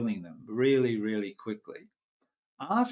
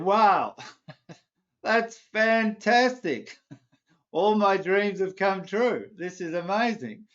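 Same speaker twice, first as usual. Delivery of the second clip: overjoyed; 9 semitones higher, 5 dB louder than usual. Acted. Man overjoyed at elder's lamp.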